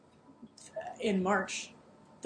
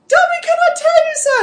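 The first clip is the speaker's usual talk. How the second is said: much higher, louder elf protest; house-elf voice; 10 dB louder than usual. Acted. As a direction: plead like a frightened Dobby. Dobby voiced in retold scene.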